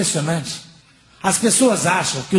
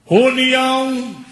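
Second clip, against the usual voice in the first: long drawn-out, higher call; bus driver's shout; 4 semitones higher, much slower than usual. Retold or imitated. Bus driver to passengers at the front, drawn-out shout. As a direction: call out the stop, stretching the word. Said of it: "o motorista grita lá na frente".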